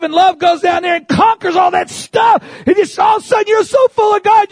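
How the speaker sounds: loud, high, driving sermon climax; preaching at full force. Acted. Preacher rousing the congregation about dwelling in love, loud and high. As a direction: build the congregation to a shout.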